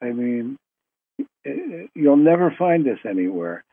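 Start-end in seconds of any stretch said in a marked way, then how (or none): none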